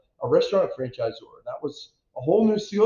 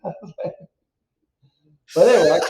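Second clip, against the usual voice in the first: laughing; 6 dB louder, much faster than usual. Real, not acted. Laughing at his own story.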